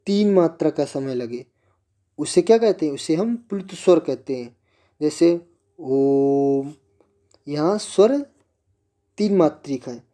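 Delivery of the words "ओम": ओम held for three beats; a long, held ओम; drawn-out om. Shown as a demonstration. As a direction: stretch ओम out long, like a chant. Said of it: "दीर्घ से भी अधिक समय लगा".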